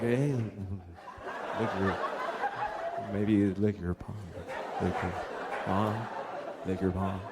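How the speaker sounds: low, soft, tentative murmur; careful asking. Retold or imitated. Husband gingerly asking his wife, low halting murmur. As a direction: sneak the request in very gingerly, haltingly. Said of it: "approach asking for those things carefully".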